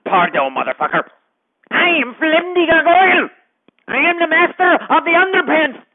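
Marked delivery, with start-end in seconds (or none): 1.7-5.8 higher, pompous declaration; hammed-up gargoyle boasting to the podcast host; grand proclamation. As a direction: proclaim your title to the world. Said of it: "gargoyle proclaiming himself".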